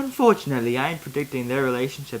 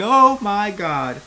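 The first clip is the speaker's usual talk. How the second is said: higher, louder, slow gasp; excited; 7 semitones higher, 7 dB louder, slower than usual. Real, not acted. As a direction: drag out the wow. Excited aside while reading.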